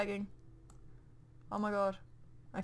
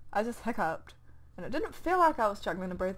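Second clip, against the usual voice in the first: ordinary delivery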